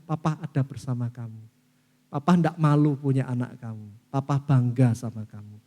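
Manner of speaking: whisper-close reassurance while hugging; tender fatherly comfort. Acted. Father patting his child's back, low tender reassurance.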